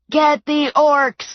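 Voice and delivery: rally the troops; battle cry. A narrator's reading. Hobbits charging the house, yelling a battle cry. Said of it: "they were yelling".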